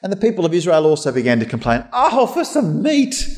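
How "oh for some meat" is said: high, whiny moan with a laugh; sing-song complaint, half laughing; mock whining. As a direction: ham up a grumbling Israelite. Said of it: "they exclaimed".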